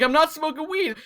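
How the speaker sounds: high, defensive retold denial; high-pitched protest. Retold protest to angry mom.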